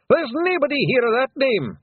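high, loud Scots shout. A narrator's reading. Rob Anybody denying his name to the lawyers, loud shout. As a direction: bluster the lawyers away. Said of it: "shouted Rob Anybody".

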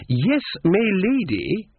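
high, drawn-out, imperious correction; haughty lady. A narrator's reading. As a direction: slowly demand deference in a grand voice. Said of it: "said the fat woman".

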